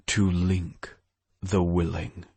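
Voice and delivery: ordinary delivery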